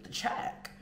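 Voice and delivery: whispered, almost no voice; whispered repeat. Retold or imitated. Whispering the check again to the puzzled waiter. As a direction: breathe the word out as a half-whisper.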